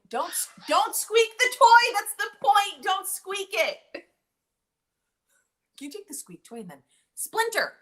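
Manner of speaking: loud, high, slow call. Real, not acted. Mom calling to her son to stop squeaking the toy, loud and high. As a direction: shout across the room, drawn out.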